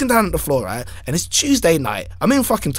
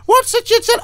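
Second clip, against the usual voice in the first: much higher, squeaky leprechaun demand; mock leprechaun. Angry leprechaun at his door, high squeaky demand.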